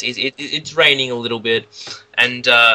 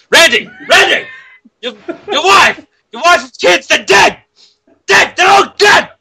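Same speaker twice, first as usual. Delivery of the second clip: frantic high-pitched yelling down the phone; random yelly man; 11 dB louder than usual. Acted. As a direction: scream it in short, hammed-up bursts. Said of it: "Who's the random yelly man?".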